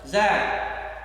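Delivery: mild mock yell from far off; distant yell. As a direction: call the name like a distant yell. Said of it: "it sounded like it said".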